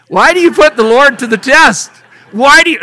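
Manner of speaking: loud, indignant questioning; loud rebuke. Acted. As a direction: challenge the crowd, raising the voice. Moses rebukes people, loud.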